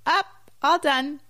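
put-on high little toddler voice; imitating a toddler asking to get up. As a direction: squeak it like a tiny toddler.

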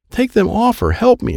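high-pitched, restrained yell; urgent high call for help. A narrator's reading. Boy calling for help pulling cards off Krista, mild yell. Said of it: "Alan yelled".

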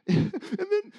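high, gasping mock sobs; imitated crying attempt to talk; sobbing stammer. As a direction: squeeze words through hitched breaths. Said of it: "I keep, like".

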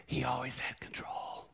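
hushed, slow whisper. Acted. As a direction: lean in and breathe it with awe. Reverent point about his role model.

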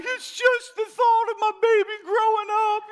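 high, wailing imitation of mom; mock crying mom. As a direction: sob it out, hammed-up and high. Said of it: "my mom starts crying".

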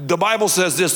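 ordinary talk

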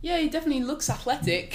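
high, amused mock agreement; lifted, uncertain tone breaking into laughter; puzzled onlookers. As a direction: imitate someone politely missing the point. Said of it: "people would look at me like".

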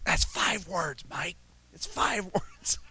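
slow, breathless words half-whispered through laughter; laughing whisper. Retold or imitated. Buddy whispering to the choir singer, laughing whisper. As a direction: giggle out the correction under your breath. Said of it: "one of his buddies says".

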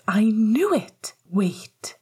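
gasping, slow astonished exclamation; drawn-out gasp of discovery. A narrator's reading. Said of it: "Lily gasped".

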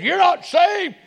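high, shouted devil's taunt. Retold or imitated. Preacher voicing the devil to the congregation, shouting mockery. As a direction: shriek it like the mocking devil. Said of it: "The devil says".

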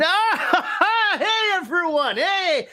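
high, laughing, fake-cheery wave to the crowd; showman greeting the crowd. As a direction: beam at the fans, all put-on cheer. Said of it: "and going".

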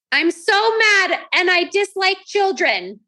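slow, high, hammed-up grumpy declaration; mock mad thought. Mother imagining her reaction to scribbled papers, mock grumpy. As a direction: drag it out like a cartoon grouch. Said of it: "choose the thought".

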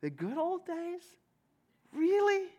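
high, sarcastic disbelief; incredulous. Real, not acted. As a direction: scoff at the idea. Mocking disbelief at the past.

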